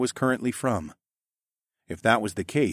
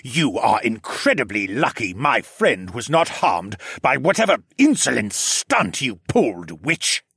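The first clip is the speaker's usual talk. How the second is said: angry scolding; 4 dB louder than usual. A narrator's reading. Regent scolds the girl.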